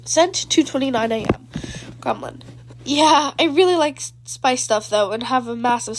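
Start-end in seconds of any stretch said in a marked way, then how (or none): none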